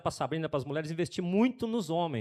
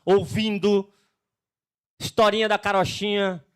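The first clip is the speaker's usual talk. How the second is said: louder, stern God calling Adam out; God confronting Adam; 5 dB louder than usual. Acted. God questions Adam about Eve, stern mock rebuke. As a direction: demand an answer like an angry God.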